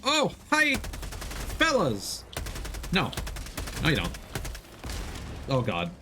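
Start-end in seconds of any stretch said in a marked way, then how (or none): none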